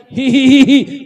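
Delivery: loud, high imitated giggle; mock laughter. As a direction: act the maid's hee-hee. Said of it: "फिर हंसने लगी".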